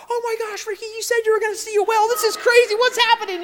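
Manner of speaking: very high, loud, fast amazed woman's voice; wife shrieking in delight from the boat; excited wife. Retold or imitated. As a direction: squeal like she can't believe it. Wife Tina voiced watching whale.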